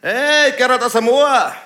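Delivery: laut und langsam gedehnt; rufender Spötter. Retold or imitated. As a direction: ruf es ihm über die Straße. Gerufener Spitzname. (Spötter ruft laut gedehnt)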